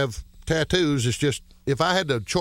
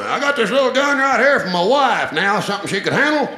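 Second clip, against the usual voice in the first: lightly voiced gun-owner boast; higher, a hint of a bragging rifle enthusiast; NRA type; 8 semitones higher, 4 dB louder than usual. Gun owner bragging about wife's gun, lightly voiced NRA type. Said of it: "these National Rifle Association type".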